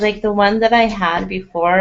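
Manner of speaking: ordinary delivery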